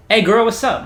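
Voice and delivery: high, loud imitation of a pushy suitor; hammed-up catcall to a girl; mock come-on. Voices strangers messaging her.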